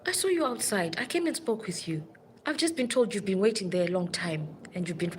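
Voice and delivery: ordinary talk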